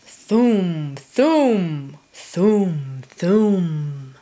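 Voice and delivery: low, slow thumping turtle call; deep plodding turtle noise; turtle sound. Acted. Turtle sound in picture book.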